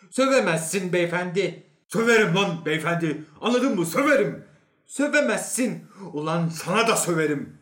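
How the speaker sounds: loud, high back-and-forth row; heated quarrel. Acted. Two men in acted quarrel.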